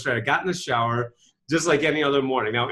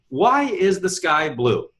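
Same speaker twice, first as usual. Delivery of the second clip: slow demo question; much slower than usual. Shown as a demonstration. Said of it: "For example".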